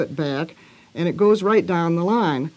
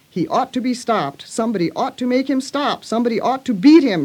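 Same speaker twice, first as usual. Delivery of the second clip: higher-pitched indignant cry, building louder; angry outcry; 6 semitones higher, 4 dB louder than usual. A narrator's reading. Girl driven angry by screams, crying out indignantly. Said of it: "she cried out".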